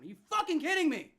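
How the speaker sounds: high, loud exasperated yelling at the defense; real fan fury, shouting at the screen; "Are you fucking kidding me" bellowed in disbelief; furious shout. Real, not acted. Fan raging at a third-down conversion.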